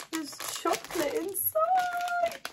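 very high, drawn-out squeal; sing-out high squeak of delight. Real, not acted. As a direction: squeal it slowly, thrilled. Delighted repeat of the discovery.

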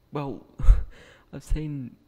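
ordinary delivery

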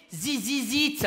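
"Zizizit" with buzzing z for every th and s; mock a French speaker saying This Is It as "Zizizit"; mangled English title. Fan lamenting the last tour, "Zizizit" with buzzed z sounds. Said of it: "je pense qu'elle parlait de This Is It".

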